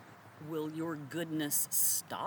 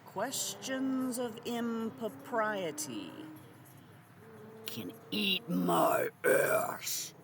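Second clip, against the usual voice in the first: slow emphatic dismissal; 5 semitones higher, much slower than usual. Acted.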